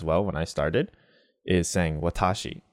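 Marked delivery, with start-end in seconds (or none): 2.0-2.6 "watashi" as "wuh-TAA-shi", TA stressed and lengthened; learner's English-stressed watashi. Shown as a demonstration. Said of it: "A couple things I did wrong there".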